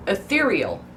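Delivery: ethereal as uh-THEER-ee-ul; correct pronunciation. Shown as a demonstration. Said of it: "Nope, in writing".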